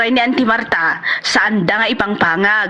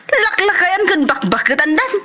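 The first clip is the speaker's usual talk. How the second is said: mocking laugh; 7 semitones higher than usual. Mocking gossip about neighbours.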